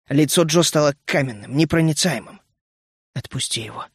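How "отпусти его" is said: hushed, much softer and lower; a dull, heavy low demand; глухо, тихо и низко; muffled and low. A narrator's reading. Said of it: "сказала она глухо".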